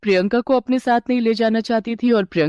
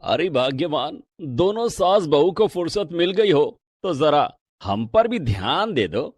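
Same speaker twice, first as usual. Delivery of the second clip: father-in-law calling; 4 semitones lower than usual. Acted. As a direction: call to the ladies with mock complaint. (ससुर की नीची, धीमी छेड़ती पुकार)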